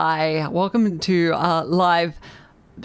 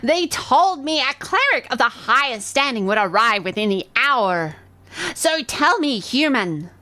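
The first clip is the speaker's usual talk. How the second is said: shrill disdainful goblin queen; 5 semitones higher than usual. Acted. Goblin chieftain scorns the cleric, high shrill disdain. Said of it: "Her voice causes you to shiver".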